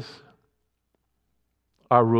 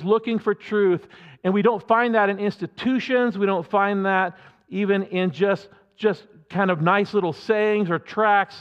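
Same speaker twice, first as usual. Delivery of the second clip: usual speaking voice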